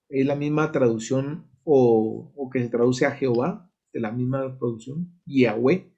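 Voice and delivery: normal talking voice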